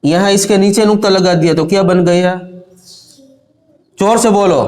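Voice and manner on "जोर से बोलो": ordinary talk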